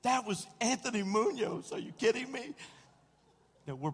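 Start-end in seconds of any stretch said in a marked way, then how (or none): 0.0-2.6 high-pitched, giddy disbelief; starstruck gushing. Retold or imitated. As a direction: gush like an overexcited fan. Gushing to friend after celebrity encounter.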